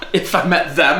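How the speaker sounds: high laughing repeat of the question. Real, not acted. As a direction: burst out laughing, then talk through it. Cracks up at Bigfoot question.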